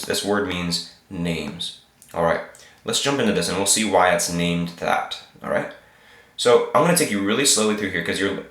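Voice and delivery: ordinary talk, nothing marked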